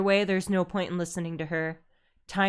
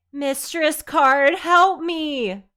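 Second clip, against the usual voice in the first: high, loud desperate plea; a bully begging her mistress; pleading cry; 9 semitones higher, 9 dB louder, much slower than usual. Acted. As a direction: beg for rescue, voice rising. Defeated bully begs.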